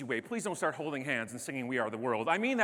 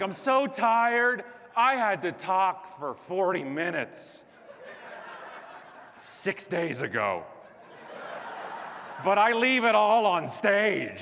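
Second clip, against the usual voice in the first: drawn-out, loud hammed-up moaning; mock exhausted complaint; 4 semitones higher, 4 dB louder, slower than usual. Speaker whining to friends with jobs about talking forty minutes, hammed-up exhaustion. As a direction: wail it slowly, as if worn out by almost nothing.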